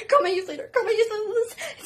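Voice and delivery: high, laughing frantic plea; half-laughing, half-crying urgency; begging a friend to call for help, laughing in panic. Retold or imitated.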